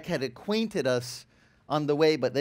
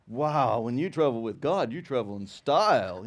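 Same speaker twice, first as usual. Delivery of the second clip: put-on lower, awed older man; dad voiced lower, amazed by the view; impressed dad; 6 semitones lower than usual. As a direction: drop the voice into his dad's wonder. Voicing his impressed dad.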